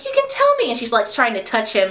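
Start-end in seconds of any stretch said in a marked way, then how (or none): 0.0-0.7 high, sugary coaxing; mock sweet coaxing. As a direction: coax him in a high girly voice.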